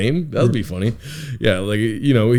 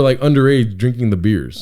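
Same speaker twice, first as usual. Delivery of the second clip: normal talking voice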